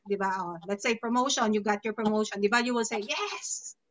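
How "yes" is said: high YES falling away; so happy. Acted. Got your promotion, so happy YES. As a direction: celebrate with a high, drawn-out YES. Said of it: "so happy".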